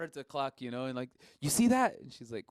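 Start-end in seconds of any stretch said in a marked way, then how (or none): 1.4-2.0 high, loud eager question; excited question. Retold or imitated. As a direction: demand she sees it too. Urging girlfriend to look.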